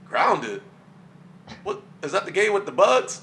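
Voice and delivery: loud, high disbelieving question; surprised. Retold or imitated. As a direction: react in shocked disbelief. Retold friend's surprised reaction.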